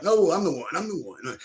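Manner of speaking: high, loud mocking squeal of I'm the one; mock claimant. Mocking everybody claiming kingship.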